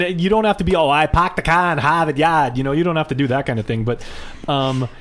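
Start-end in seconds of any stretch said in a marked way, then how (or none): 0.7-2.6 exaggerated Boston accent. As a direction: lay on a thick Boston accent. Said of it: "captured the Boston accent".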